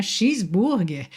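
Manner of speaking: Cheeseburger as shizburger, slowly; Brazilian pronunciation. Shown as a demonstration. Teacher showing Brazilian Cheeseburger, slowly. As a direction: say Cheeseburger like a Portuguese word. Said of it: "com a pronúncia brasileira".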